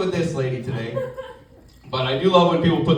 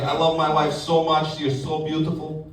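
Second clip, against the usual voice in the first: Russian coworker; 2 semitones lower than usual. Acted. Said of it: "I work with a guy from Russia".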